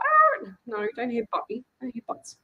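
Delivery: high pleading pet voice; squeaky whine, voicing the dog; Bob's voice. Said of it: "And he was like".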